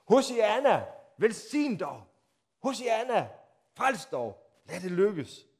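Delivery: the crowd hailing Jesus, cheering. Acted. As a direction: call out like the crowd.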